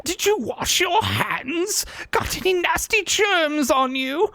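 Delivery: very high, squeaky cartoon voice; squeaky character voice. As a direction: squeak like a panicky cartoon critter.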